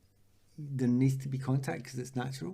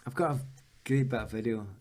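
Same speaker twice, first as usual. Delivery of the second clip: ordinary delivery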